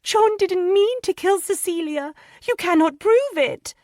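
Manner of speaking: very high, loud pleading cry; a young wife crying out for her husband; crying woman's plea. A narrator's reading. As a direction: beg through tears in a high woman's voice. Lydia defends her husband.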